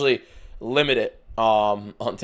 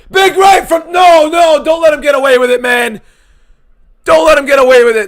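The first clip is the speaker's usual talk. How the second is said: much higher and louder, screaming at the screen; furious shout; 13 dB louder than usual. Real, not acted. Streamer screaming at the fight, high and loud. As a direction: yell at the fighter not to escape. Fighter escaping after getting hurt.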